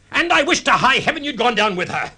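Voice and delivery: a high, loud wish of ill; spiteful outburst. Acted. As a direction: spit out the wish with venom.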